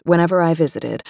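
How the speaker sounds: ordinary talk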